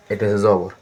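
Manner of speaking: ordinary talk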